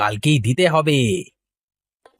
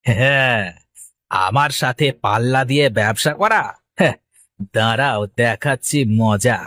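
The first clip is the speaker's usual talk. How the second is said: low, menacing aside; lightly villainous muttered threat; scheming villain; 8 semitones lower than usual. Acted. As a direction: plot revenge in a low voice. Rival plots revenge alone.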